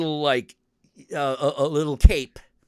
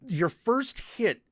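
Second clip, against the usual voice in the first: ordinary talk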